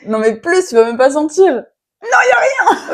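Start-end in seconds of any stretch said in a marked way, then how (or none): 2.0-2.9 high, laughing shout; laughing protest. Real, not acted. Teasing over the chili amount.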